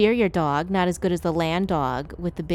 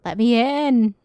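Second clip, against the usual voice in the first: loud plea; 3 semitones higher, 7 dB louder, slower than usual. Acted. Begging to be let in.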